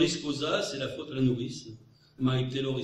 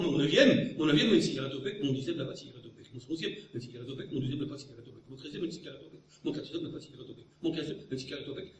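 fast rapid-fire riddle lines; speeding up; much faster than usual. Retold or imitated. Orlando teases at recess, accelerating rapid recital. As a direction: rush through, teasing. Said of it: "il poursuivait en accélérant".

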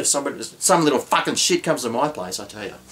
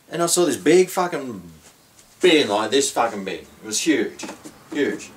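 usual speaking voice

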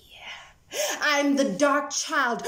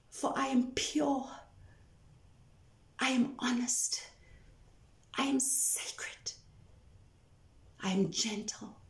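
whispered; 9 dB quieter than usual. Acted. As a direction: offer each quality softly. Poem, whispered litany.

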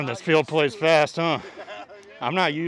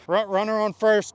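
usual speaking voice

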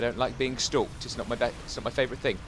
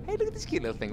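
much higher, softer cutesy voice; mock cooing; 13 semitones higher, 6 dB quieter, faster than usual. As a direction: coo at the alien like it's a pet. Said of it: "I'm pretending you're cute".

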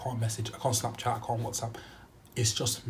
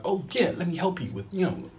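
sleazy helper voice; 7 semitones higher, 3 dB louder than usual. Retold or imitated. Crackhead offering help, voiced.

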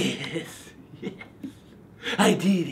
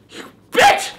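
shrieking; 4 dB louder than usual. Acted. Raging at pumpkin carving tools.

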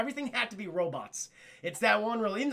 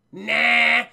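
very loud, dismissive one-word reply; blunt refusal; 2 semitones higher, 12 dB louder than usual. Acted. Mercenary turning down three talking dogs, loudly dismissive.

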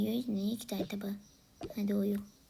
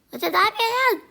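much higher, much louder alarmed shout; 11 semitones higher, 12 dB louder than usual. Acted. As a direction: shout at her for climbing up. Sibling climbing on her head. (telaşla bağırarak)

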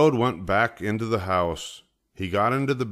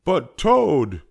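high, loud plea; pleading protest; 9 semitones higher, 7 dB louder than usual. A narrator's reading. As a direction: object loudly, pitched high. Frog protests to sleepy Toad.

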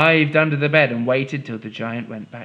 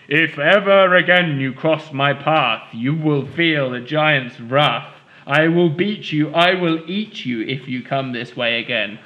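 roaring giant. Acted. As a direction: menace the girls as a furious giant. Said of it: "and roar".

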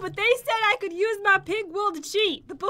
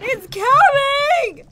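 loud, high, drawn-out wail; stretched-out shriek of alarm; panicked scream; 6 semitones higher, 9 dB louder, much slower than usual. Real, not acted. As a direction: scream it slowly as danger closes in. Screams as lava closes in.

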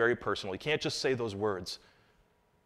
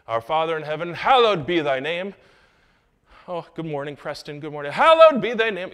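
put-on lofty old-fashioned prayer voice, building louder; hammed-up churchy prayer; mock formal prayer; 4 semitones higher, 7 dB louder than usual. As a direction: pray like reciting King James. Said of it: "we don't even talk like that".

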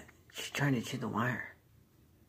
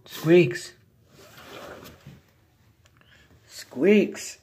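calling out; 4 semitones higher, 12 dB louder than usual. Real, not acted. Calling hiding pet.

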